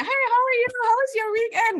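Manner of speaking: very high, bright sing-song hello; cheery greeting imitation. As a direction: greet like an overfriendly coworker.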